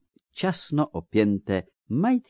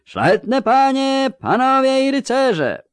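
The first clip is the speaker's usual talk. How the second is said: shouted announcement; 6 dB louder than usual. A narrator's reading. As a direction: hail the gathered nobles across the courtyard. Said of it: "krzyknął".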